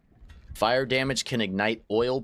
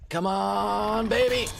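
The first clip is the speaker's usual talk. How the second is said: excited; 11 semitones higher, slower than usual. Real, not acted. Cheering on crafting the dragon.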